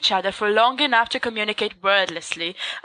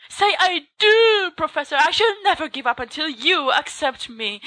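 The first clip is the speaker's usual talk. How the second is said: mock-heroic lover's cry; dramatic cry; 7 semitones higher than usual. A narrator's reading. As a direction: throw yourself into a melodramatic proposal. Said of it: "Sirius cries".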